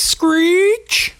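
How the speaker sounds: high squealing brake screech; mock screech. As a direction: squeal it like tires braking. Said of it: "That's my onomatopoeias".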